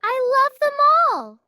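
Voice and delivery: loud, high, thrilled call; thrilled kid, loud and high; excited child. Acted. Child adores the dogs.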